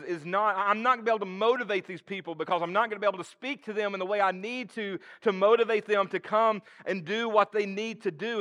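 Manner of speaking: high, loud, stammering plea; a panicked man insisting he can't speak; frantic stammering Moses. Acted. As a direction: beg out of the job, tripping over words. Moses pleads he cannot speak.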